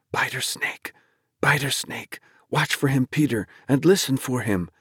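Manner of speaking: high, hushed fearful warning; trembling thought. A narrator's reading. Dennis warns himself about Flagg, trembling and fearful. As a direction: think it anxiously in a high, breathy whisper that gains voice. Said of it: "he would draw aside trembling, thinking".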